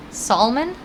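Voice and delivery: "salmon" as SOL-mun, rounder vowel and sounded L. Shown as a demonstration. Streamer tries another way for chat, salmon as SOL-mun. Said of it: "Now you got me questioning my life".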